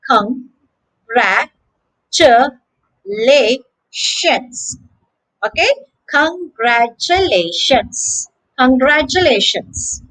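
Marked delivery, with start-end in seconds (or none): 0.1-4.8 Congratulations in five slow, separated syllables; long gaps between each syllable of Congratulations. Shown as a demonstration. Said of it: "a total of five syllables". 6.1-8.3 congratulations slowly, each syllable distinct; careful slowed congratulations with slight breaks; slow, syllables marked. Shown as a demonstration. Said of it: "a total of five syllables".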